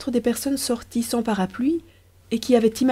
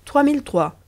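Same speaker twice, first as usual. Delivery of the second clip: higher, questioning repeat of the price; mock surprise at the price; 6 semitones higher than usual. A narrator's reading. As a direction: echo the sum as if it's a lot. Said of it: "répéta Riquet".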